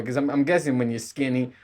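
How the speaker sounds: ordinary talk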